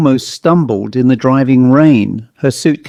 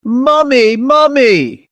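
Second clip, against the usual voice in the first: high, drawn-out scream for help; 12 semitones higher, slower than usual. A narrator's reading. Miss Harper flees the doll, screaming high. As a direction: scream it out high, like a frightened child. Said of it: "she screamed".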